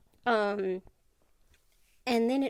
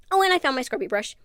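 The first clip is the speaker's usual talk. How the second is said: excited outburst; 3 semitones higher, 10 dB louder, faster than usual. Real, not acted. Sudden excited remembering.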